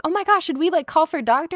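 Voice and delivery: high-pitched fretting bystander; mock concern. Onlookers after Hermione faints, mock concern. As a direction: fuss in a high, put-on worry.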